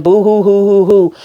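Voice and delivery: boo-hoo fake crying; mock his crying, boo hoo; mock sobbing. Mocking him crying over Sharon.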